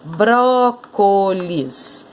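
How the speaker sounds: "Brócolis" drawn out slowly in separate syllables. Shown as a demonstration. Teacher showing how to separate "Brócolis", syllable by syllable. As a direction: split "Brócolis" into three slow syllables. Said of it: "você vai separar a sílaba aqui".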